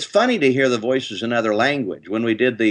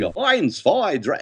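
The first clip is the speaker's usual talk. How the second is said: German counting; 6 semitones higher, slower than usual. Shown as a demonstration. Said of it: "a German is kind of an up in the front of the mouth voice".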